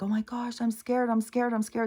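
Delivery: put-on high, frightened little-girl voice; mimicking the frightened daughter, pitched high; scared little girl. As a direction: whimper it like a scared kid. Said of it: "starts getting all upset and like".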